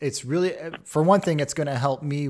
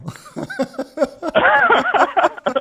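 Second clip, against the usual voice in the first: loud high laugh; 8 dB louder than usual. Real, not acted.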